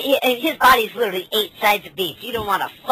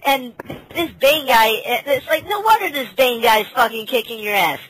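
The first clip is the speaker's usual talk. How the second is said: ordinary delivery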